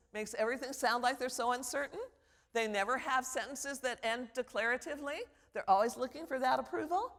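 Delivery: uncertain upspeak, each sentence end lifted; rising intonation. Shown as a demonstration. Professor mimics students' upspeak, endings rising in uncertainty. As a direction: let "approval" climb like a question. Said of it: "is that rising intonation at the end of their sentences".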